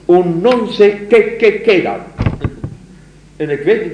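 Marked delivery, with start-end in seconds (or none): 0.1-2.0 "qué, qué" stuttered high and loud, slow; stammering Spanish. Shown as a demonstration. Lecturer demonstrates the poet's imitated stammer in Spanish. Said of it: "imiteert hij perfect dat stotteren".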